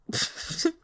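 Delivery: breathy little laugh; soft laugh. Acted. Boss laughing off the legal question, soft laugh. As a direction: let out a quiet scoffing laugh. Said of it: "Zhao Yunlan's turn to laugh".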